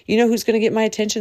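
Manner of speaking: quick excited lead-in; rapid build. Real, not acted. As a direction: rush toward the reveal.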